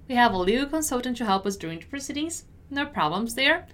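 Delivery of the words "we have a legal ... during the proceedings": fast, connected read; brisk model sentence; quicker repeat. Shown as a demonstration. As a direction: run it together at natural speed. Teacher repeats at quicker pace.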